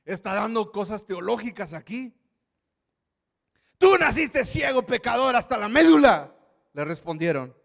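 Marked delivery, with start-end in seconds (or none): 3.8-6.3 louder, higher scornful shout; furious insult. Acted. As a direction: spit the insult at him. Pharisees insult the healed man.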